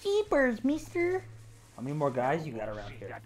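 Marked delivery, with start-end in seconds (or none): 0.0-1.2 put-on high, gee-whiz kid exclaiming; wholesome 1950s kid. Goofy exclaim at enemy.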